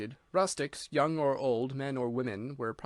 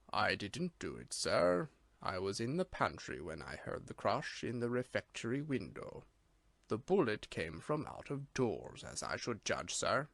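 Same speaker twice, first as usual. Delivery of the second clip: lower, softer, solemn manservant with a slight Irish lilt; a grave butler, quiet and correct, lightly voiced; Bates, solemn servant; 4 semitones lower, 6 dB quieter than usual. A narrator's reading. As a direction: deferential servant murmuring low and solemn. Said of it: "nor was there anything insolent in his tone".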